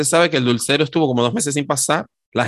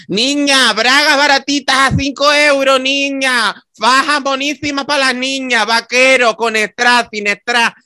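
high, loud market hawker cry; street vendor call; 9 semitones higher, 4 dB louder, slower than usual. Retold or imitated. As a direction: sing out wares like a street seller. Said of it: "Entonces escucha".

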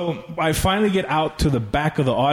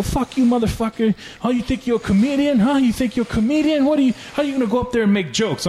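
mocking, aggressive voice of a guy yelling; retold angry heckler; 9 semitones higher than usual. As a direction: imitate an angry guy picking a fight. Retold angry guy confronting him.

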